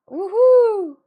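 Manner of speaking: loud, high drawn-out whoop; yawning woohoo. Shown as a demonstration. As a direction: yawn and whoop at once. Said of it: "can't yawn and woohoo at the same time".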